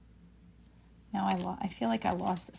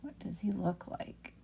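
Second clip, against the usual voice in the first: whispering; 13 dB quieter than usual. Real, not acted. Muttering to herself while painting.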